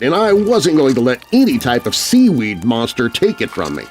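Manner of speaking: usual speaking voice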